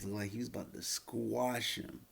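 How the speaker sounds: usual speaking voice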